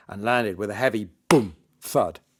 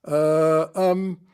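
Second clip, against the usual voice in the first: scared hesitation; 7 semitones higher, 6 dB louder than usual. Acted. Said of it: "the magician looked scared".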